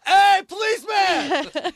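high, slow shout to a passing cop; calling out. Retold or imitated. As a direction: call out across the street. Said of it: "I go".

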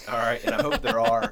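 laughing while talking; words through a real laugh. Real, not acted. Laughing self-deprecating aside.

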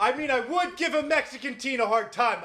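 high-pitched, defensive protest; hammed-up high-voiced objection; mock indignation. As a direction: play an affronted cop justifying himself. Continues voicing the indignant cop.

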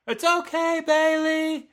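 crowd yelling. Retold or imitated. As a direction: call out comfort like a fan in the stands. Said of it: "you hear people yell".

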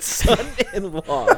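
stretched-out laughing repeat. Real, not acted. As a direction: laugh through the words in disbelief. Incredulous repeat of absurd twist.

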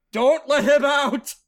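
slow, high, loud plea; urgent shout. Acted. Pleading that Spider not be let out, shouted. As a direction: yell it as a desperate order.

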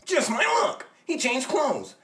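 loud, high excited shout. Acted. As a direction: yell it out in frustration.

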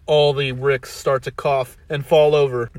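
ordinary delivery, nothing marked

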